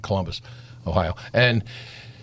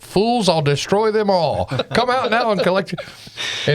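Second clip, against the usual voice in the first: slow, higher mock villain proclamation; villain quote; 7 semitones higher, slower than usual. Quoting the villain, mock-menacing proclamation. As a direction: ham up a movie villain's threat. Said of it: "who's famous for the quote".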